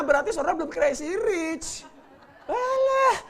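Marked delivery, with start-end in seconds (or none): 2.5-3.2 "Alah" bernada tinggi; pura-pura menepis dengan cuek; seruan meremehkan. Acted. Menirukan orang kaya yang cuek. (seruan tinggi ala orang kaya cuek)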